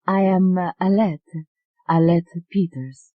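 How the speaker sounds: lightly voiced Italian woman; Italian accent. Alette telling the doctor her name, lightly Italian accent. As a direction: introduce yourself shyly, lightly Italian.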